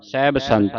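ਸਾਹਿਬ squashed into "saib", run into ਸੰਤ; ਸਾਹਿਬ as one syllable "saib"; common misreading. Shown as a demonstration. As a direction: imitate readers dropping the h of ਸਾਹਿਬ. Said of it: "ਕਈ ਸੰਗਤਾਂ ਨਾ ਸਹਿ ਪੜ ਜਾਂਦੀਆਂ".

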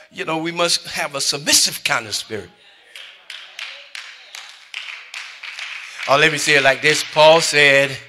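high, loud, driving pulpit preaching; full-force preaching. Acted. Preacher rousing congregation, loud and high. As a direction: preach it hard and loud to the church.

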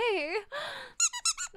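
high, drawn-out gasp; delighted gasp. Real, not acted. Streamer greeting Friday, high delighted gasp. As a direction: suck in air with delight.

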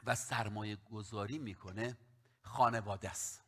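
ordinary talk

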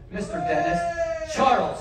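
slow, drawn-out showman announcement; grand slow presentation of the volunteer; announcer intro. Acted. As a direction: stretch the name like a ring announcer.

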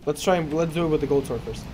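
ordinary talk, nothing marked

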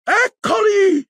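very high, loud drawn-out cry; shriek. A narrator's reading. Bellatrix spots the fleeing group, shrieking. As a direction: shriek it, stretching the word. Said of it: "strillò lei".

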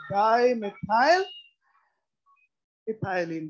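drawn-out syllables, rising at the end; slow dictation. Real, not acted. Teacher writes the name as students follow, slow dictation. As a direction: stretch it out and leave it hanging.

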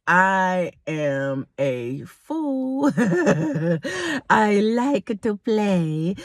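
drawn out word by word, with laughter; playful slow confession. Real, not acted. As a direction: spell it out very slowly, teasing. Jokey confession to listeners.

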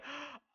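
surprised gasp. Real, not acted. Surprised at chat messages.

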